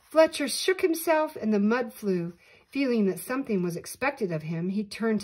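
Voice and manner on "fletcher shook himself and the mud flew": ordinary delivery, nothing marked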